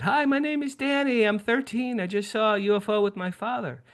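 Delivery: very high-pitched put-on kid voice; excited thirteen-year-old boy. Boy phoning the local newspaper about a UFO, high and excited. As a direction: pipe up like an eager young caller. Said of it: "and I said, ... I'm thirteen".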